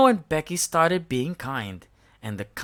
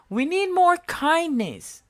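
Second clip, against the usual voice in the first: much higher, louder, leaning on more; Bella voice; 13 semitones higher, 4 dB louder than usual. A narrator's reading. Bella rallying everyone, high and loud. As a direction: urge the others on. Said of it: "Bella said".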